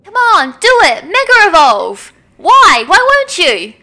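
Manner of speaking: high, loud frustrated demand; desperate shout. Acted. Zinnia begging Rayquaza to mega evolve, desperate shout. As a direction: yell at the dragon to obey.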